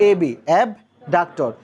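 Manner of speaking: এবি abductor drawn out very slowly; slow teaching emphasis. Shown as a demonstration. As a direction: spell out the letters, then the word, at half speed. (খুব ধীরে, শিক্ষকের মতো জোর দিয়ে)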